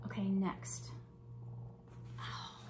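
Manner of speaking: whispered aside. Real, not acted. Muttering to herself while unwrapping.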